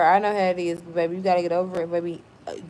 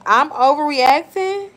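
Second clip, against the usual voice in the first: incredulous question; 6 semitones higher, 6 dB louder, slower than usual. Real, not acted. Incredulous at commenter's claim.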